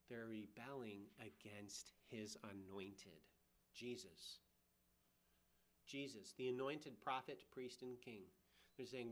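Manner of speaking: usual speaking voice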